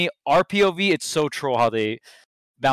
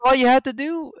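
high-pitched, pleading complaint; exasperated scolding; 9 semitones higher, 4 dB louder than usual. Real, not acted. Chiding players who posted their tech too early, exasperated.

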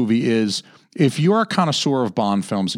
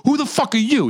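mock demanding thug; 11 semitones higher than usual. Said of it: "When somebody asks Felix Leiter".

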